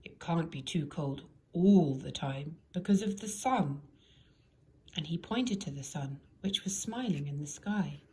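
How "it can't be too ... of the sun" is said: lightly voiced wizard. A narrator's reading.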